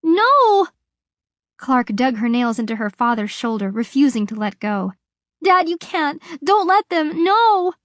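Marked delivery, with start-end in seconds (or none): 0.0-0.7 desperate scream. A narrator's reading. Girl dragged from father. 5.4-7.7 desperate pleading. A narrator's reading. Girl clinging to father.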